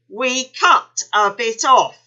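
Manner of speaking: loud, slow mock-menacing cry. A narrator's reading. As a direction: boom it to scare the kangaroo. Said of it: "he cried".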